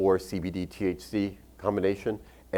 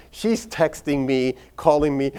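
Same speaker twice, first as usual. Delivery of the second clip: ordinary delivery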